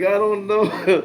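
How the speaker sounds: high, loud, slow mock Filipino accent; imitating an old Filipino coworker in a bad Filipino accent; terrible Filipino accent. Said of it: "Terrible Filipino accent".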